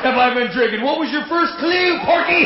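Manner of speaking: high mocking drunk shout; silly clown.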